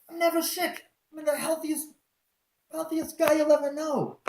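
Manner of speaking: very high, loud incredulous protest; retold outburst of disbelief; indignant disbelief. Protesting the cancer diagnosis.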